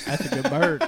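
can barely get it out for laughing; laughing through quote. Retold or imitated. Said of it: "He goes".

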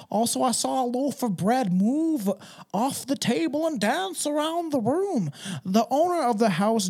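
high, lilting mock old-time Scottish accent. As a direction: read it out like a Scotsman of long ago, hammed-up accent. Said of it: "what Scottish people sounded like back then".

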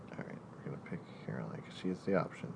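fast quick whisper. Real, not acted. Host picking Caroline, hurried whisper. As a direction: mutter the pick under your breath. Whispered aside choosing answer.

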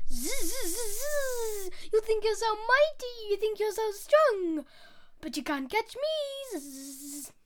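high, taunting fly voice; Fly taunting the sleepy lion, high and buzzy. Acted. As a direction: tease like a cocky little fly. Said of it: "a different one for Fly".

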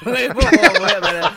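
loud comic outburst breaking into laughter; laughing mock alarm. Friend clowning after a princess impression, loud and laughing. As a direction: blurt it high and loud through laughs.

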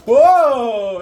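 loud, high startled "Whoa!"; surprised shout. Acted. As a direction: jump back in shock. GM voices surprised pirate.

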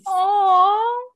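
high, loud, drawn-out coo; long melting coo, pitched high; cooing "aww". Real, not acted. Co-host moved by the Highland wildcat, cooing.

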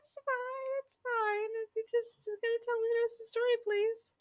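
put-on high, quiet, pleading child; kid voice. The grandson begs to hear the rest, kid voice. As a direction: beg like a little kid. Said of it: "And the kid's like".

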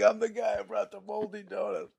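mock embarrassed confession, half under the breath; lightly voiced guilty donut guy; sheepish self-quote. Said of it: "I was like".

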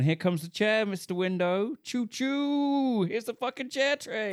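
put-on high sing-song kid voice; playful train voice. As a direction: chug it out like a toy train. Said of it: "picking up a chair and going".

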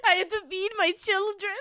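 laughing mock-dramatic cry. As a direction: wail it while cracking up. Mock dramatic plea, joking.

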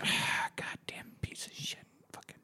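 whispered, seething mock grumble; cursing under breath. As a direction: mutter curses after someone who has left. Woman left behind, cursing him.

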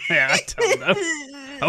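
slow, laughing through the words. Real, not acted. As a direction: give up with a laugh. Laughing at not knowing.

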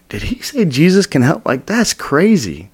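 high-pitched amazed question, slowing at the end; astonished inner voice; incredulous. Retold or imitated. Retells his amazed inner reaction.

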